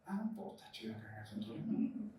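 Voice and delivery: whispered crowd gossip; murmuring. Acted. Townsfolk murmuring as the centurion comes, whispered. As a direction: mutter it under the breath, barely audible. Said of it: "en las murmuraciones decían".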